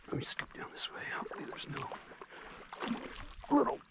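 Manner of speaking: hushed whisper while wading in. Real, not acted. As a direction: whisper it nervously under your breath. Creeping into the cold pond.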